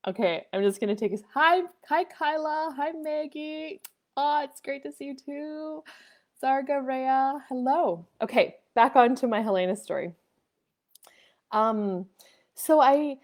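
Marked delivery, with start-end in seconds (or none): 1.3-8.1 high, drawn-out sweet hellos; friendly greeting. Real, not acted. Streamer greeting viewers by name, sweetly high.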